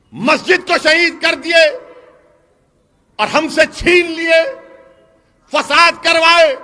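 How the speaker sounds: loud, rousing rally oratory; preaching at full force. Acted. Speaker rallying the crowd against injustice, fiery and loud. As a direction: rouse the crowd, hammering each line.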